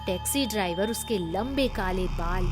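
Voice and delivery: ordinary delivery, nothing marked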